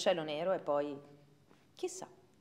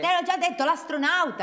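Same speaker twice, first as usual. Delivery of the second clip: exasperated shout; 9 semitones higher, 8 dB louder than usual. Acted. Child exasperated, repeating herself.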